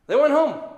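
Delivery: big loud high reveal after a pause; punchline after a drum roll. Shown as a demonstration. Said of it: "drum roll".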